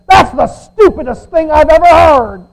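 loud, high incredulous dad retort; Bill Cosby. Retold or imitated. Bill Cosby scolding his son Theo, loud incredulous retort. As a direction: boom it like an exasperated TV dad. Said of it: "And Bill Cosby said".

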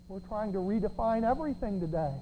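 ordinary talk, nothing marked

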